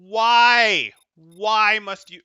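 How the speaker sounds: loud, drawn-out despairing "why"; exasperated outburst. Real, not acted. Coach protesting a player's baffling move, loudly exasperated.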